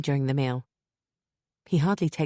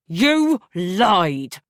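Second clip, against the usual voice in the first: loud, higher accusation; shouting; 4 semitones higher, 9 dB louder than usual. Acted. Husband shouting at his wife, loud accusation. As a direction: hurl the accusation at her. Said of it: "he shouts".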